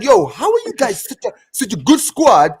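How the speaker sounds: high-pitched, incredulous complaint; exasperated quote. Retold or imitated. Fan confronting his underachieving national team, exasperated. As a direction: plead with the team in frustration, drawing out the end. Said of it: "get under my skin. I'm like".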